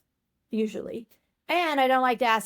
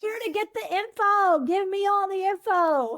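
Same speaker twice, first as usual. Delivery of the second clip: higher, playful greedy voice; mock greedy; 9 semitones higher than usual. Joking with the host, playing the greedy researcher. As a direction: ham up wanting the info.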